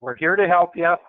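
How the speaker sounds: ordinary talk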